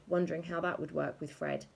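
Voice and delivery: ordinary delivery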